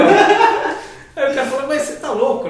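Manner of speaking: high, loud talk through real laughter; laughing. Real, not acted. Guest resumes the story after host's confession, laughing. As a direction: keep telling it while still laughing.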